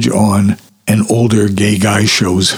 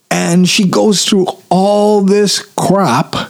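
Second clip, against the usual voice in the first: exasperated; 9 semitones higher than usual. Real, not acted. Exasperated recap of Dorothy's ordeals.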